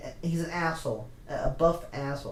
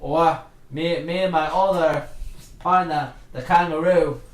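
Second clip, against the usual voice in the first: louder hammed-up Australian accent; loud mock Australian; 7 dB louder than usual. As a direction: shout it as a brash Aussie. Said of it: "Australian Wolverine".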